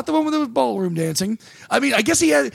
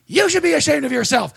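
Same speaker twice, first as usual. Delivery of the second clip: mock scolding old lady; 7 semitones higher than usual. Old lady scolds comic on stage.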